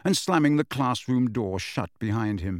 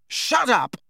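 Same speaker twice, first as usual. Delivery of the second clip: higher, louder furious yell; shout; 12 semitones higher, 3 dB louder than usual. A narrator's reading. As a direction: cut him off. Harry yells at Peeves.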